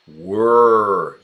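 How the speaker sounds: were said alone, loud and drawn out; were, stretched and firm like a teacher; stress on were. Shown as a demonstration. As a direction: lean hard on the word were. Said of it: "for hypothetical".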